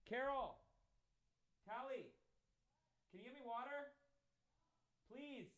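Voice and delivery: high-pitched call away from the mic. Real, not acted. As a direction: call for an assistant in a high, distant voice. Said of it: "Why are you yelling?".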